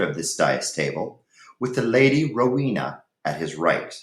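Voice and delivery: story narration. Acted. Teacher reads Ivanhoe chapter two to students, storytelling.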